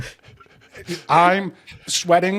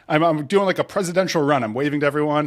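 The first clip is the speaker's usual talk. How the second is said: ordinary delivery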